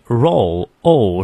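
"roll" with a drawn-out O glide; full diphthong. Shown as a demonstration. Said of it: "双音念到位". (“roll”里的O双音拉长念到位)

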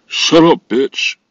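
loud, high, sudden snap; angry outburst. Real, not acted. Frustrated at the search app, sudden loud snap. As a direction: bark it at the screen.

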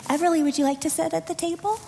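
sweet voice to the little ones. Real, not acted. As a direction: coax the toddlers kindly. Inviting a child to sit.

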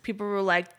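normal talking voice

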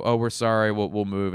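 loud quick apology; sorry, calling it out; apologetic. Retold or imitated. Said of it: "and we go".

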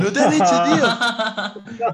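loud, slow laughing outcry; laughing disbelief. Real, not acted. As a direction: burst out in amused disbelief. Mocking friend's lack of questions.

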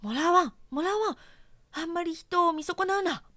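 high, loud indignant yell; shouting mousetrap. A narrator's reading. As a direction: shout down the false accusation. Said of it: "とネズミ捕りは怒鳴りました". (甲高く怒鳴るネズミ捕り)

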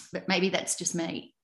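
ordinary delivery

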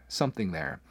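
ordinary delivery

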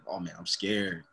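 imitating a scared loser, softly. Mocking people who go in scared, quiet and low. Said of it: "there saying".

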